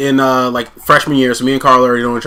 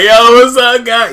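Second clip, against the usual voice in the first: put-on high, loud drunken bro greeting; 10 semitones higher, 8 dB louder, slower than usual. Imitating a drunk friend's greeting, loud bro voice. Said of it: "he kinda turned into a bro".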